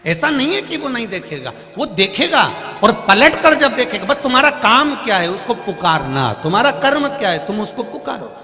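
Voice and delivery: high, loud preaching climax; fervent preaching. Acted. Preacher urging devotees to call on God, fervent and loud. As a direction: preach it out with full conviction.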